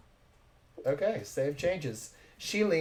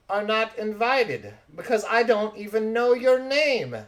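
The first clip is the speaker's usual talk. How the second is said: raised, higher voice of an offended lady; indignant snub; 8 semitones higher, 6 dB louder than usual. Acted. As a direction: refuse them in theatrical indignation. Thelma snubs rude Kirkendall.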